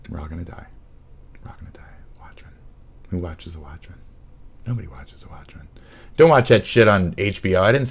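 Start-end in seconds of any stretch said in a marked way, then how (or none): 0.1-5.7 very soft, near-whispered doom; ominous hush. Pretend. Streamer foretelling doom to viewers, hushed and ominous. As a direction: let it sink into a low, eerie murmur.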